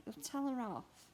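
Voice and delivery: usual speaking voice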